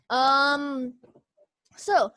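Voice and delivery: ordinary delivery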